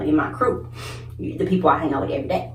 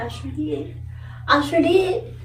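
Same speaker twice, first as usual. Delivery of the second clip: higher, cheeky confirming; proud admission; 9 semitones higher, slower than usual. Retold or imitated. Admitting to mama on the phone, higher and cheeky. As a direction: own it with a smirk. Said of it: "I said".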